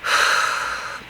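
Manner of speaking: long unvoiced sigh of frustration; frustrated sigh. Real, not acted. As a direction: let out a tired, fed-up breath. Frustrated pause mid-story.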